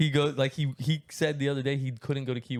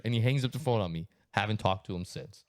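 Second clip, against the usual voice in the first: ordinary talk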